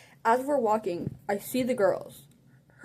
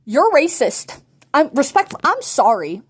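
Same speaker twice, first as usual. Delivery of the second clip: louder, blunt call-out; mock scolding; 3 semitones higher, 9 dB louder than usual. Real, not acted. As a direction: scold her then fake apology.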